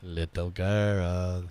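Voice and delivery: slow, creepy whisper-like drawl. Pretend. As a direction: draw it out like a horror movie creep. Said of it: "That just turned really creepy".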